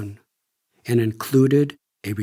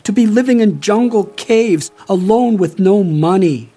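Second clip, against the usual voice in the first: higher and louder, an anxious father's cry; worried plea; 7 semitones higher, 6 dB louder than usual. Retold or imitated. Father begging his son in the jungle, anxious cry. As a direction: lift the voice with fearful worry. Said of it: "He continued".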